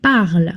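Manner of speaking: "parle" said parl, with the ending dropped. Shown as a demonstration. Teacher showing the silent verb ending in "parle". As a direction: end "parle" crisply on the l. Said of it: "So this is pronounced".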